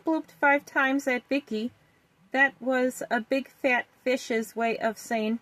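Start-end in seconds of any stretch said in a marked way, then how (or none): none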